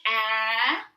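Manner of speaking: A as the short vowel in "cat", drawn out; short A sound. Shown as a demonstration. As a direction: hold A loudly as a short phonics sound. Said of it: "making its regular short sound".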